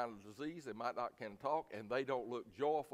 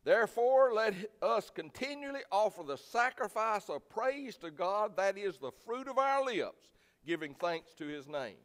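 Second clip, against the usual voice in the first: scripture reading; 7 semitones higher, 6 dB louder than usual. Acted. Pastor reading Ephesians to the church: raised solemn scripture reading. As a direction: read it out as holy writ.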